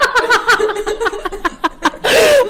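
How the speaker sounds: long high laugh. Real, not acted. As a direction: burst out laughing. Cracking up.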